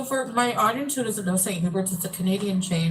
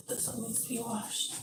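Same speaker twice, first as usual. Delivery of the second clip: whispered aside to herself; whispering; 4 semitones higher, 12 dB quieter than usual. Real, not acted. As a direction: drop to a whisper.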